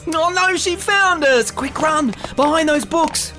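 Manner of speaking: high, urgent alarm; mock panic. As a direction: urge everyone to flee, playfully panicked. Found out, fleeing the librarian.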